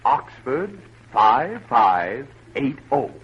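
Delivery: slow, deliberate spelling-out of a phone number; careful, drawn-out dictation. Acted. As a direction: say each number slowly so the child can keep it. Doctor dictates number to memorize.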